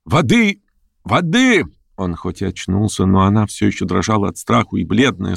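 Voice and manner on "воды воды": высокий испуганный зов о помощи; выкрикни резко вверх, в испуге; испуганный крик. A narrator's reading. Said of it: "Раздался её испуганный крик". (испуганно, высоким голосом)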